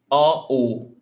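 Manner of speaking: an open O, then a closed O. Shown as a demonstration. Teacher testing learners on the difference, open then closed. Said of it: "can you spot the difference?".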